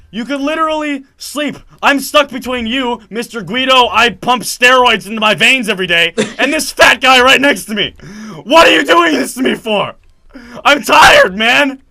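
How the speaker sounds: high, loud rant building to a wailing shout; angry outburst. Retold or imitated. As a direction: re-enact losing it at a seatmate, desperate for sleep. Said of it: "I'm just angry".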